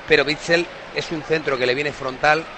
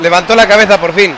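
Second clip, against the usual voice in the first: loud exclamation; 5 semitones higher, 13 dB louder than usual. Real, not acted. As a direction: exclaim it loudly. Commentator exclaims.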